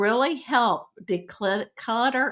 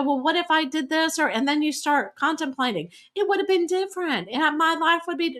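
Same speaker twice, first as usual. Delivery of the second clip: higher, fretful second-guessing; anxious what-if voice; 6 semitones higher than usual. Retold or imitated. Coach imitating a listener's regretful thoughts, higher and fretful. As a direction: mimic someone fretting over the past.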